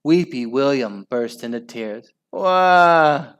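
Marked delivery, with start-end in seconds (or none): 2.3-3.4 put-on high, babyish bawl; hammed-up crying wail; loud wail. A narrator's reading. Weepy William bursting into tears, wailing. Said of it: "wailed Weepy William".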